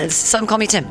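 usual speaking voice

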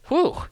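a loud, high blown-out whew of relief; relieved whew. Real, not acted. Host finishing a long article read, a loud relieved whew. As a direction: puff out the breath like a weight just dropped.

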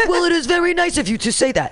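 high-pitched mock presidential thanks; reading as the president. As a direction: play the president accepting flattery. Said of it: "You'll know when it says the president".

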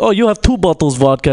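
put-on Russian accent. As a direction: imitate a Russian speaking English. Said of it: "I do love doing the Russian accent".

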